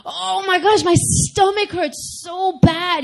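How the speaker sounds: high, stretched-out whine of agony; acted pain wail.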